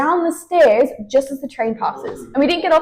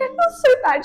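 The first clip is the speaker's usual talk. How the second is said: imitating giggly friend; 13 semitones higher than usual. Retelling Celia on the train after not paying, high and giddy. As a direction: squeal it out like a friend laughing at a bad situation. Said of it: "Celia starts laughing like a maniac".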